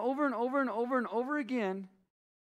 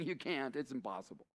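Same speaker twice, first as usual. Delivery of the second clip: a hint of a kindly king, much softer; gentle king; 8 semitones lower, 11 dB quieter than usual. King to his debtor, quietly and kindly. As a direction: softly break it to him that it can't be done.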